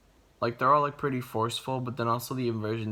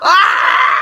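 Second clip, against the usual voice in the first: excited scream; 18 dB louder than usual. Real, not acted. Cheers at reveal.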